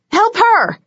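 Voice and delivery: high, loud cry for help; desperate yell to Bones; urgent yell. A narrator's reading. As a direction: shout it across the room. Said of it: "I yelled to Bones".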